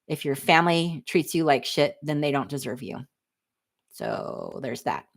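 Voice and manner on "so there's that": breathy dismissive whisper; whispered aside. Real, not acted. Closing her family rant with a whispered aside.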